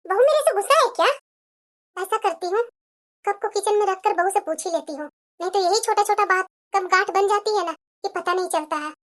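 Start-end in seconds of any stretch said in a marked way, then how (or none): 2.0-8.9 soft, low self-talk; hushed private musing, well below conversational level; musing to herself. Acted. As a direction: think it over quietly, half to yourself. Mother-in-law thinking to herself.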